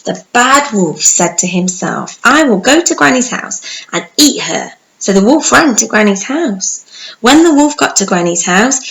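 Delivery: bright, lively storybook narration; animated storytelling voice; reading to children. Acted. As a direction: tell it like bedtime story for little ones.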